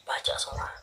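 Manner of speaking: breathy whispered echo. Real, not acted. Reader whispers the card's message to herself. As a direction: whisper it to yourself as it sinks in.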